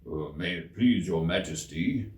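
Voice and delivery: low, humble gardener pleading; bow and plead meekly. A narrator's reading. Gardener kneels before the queen, low humble plea. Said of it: "in a very humble tone".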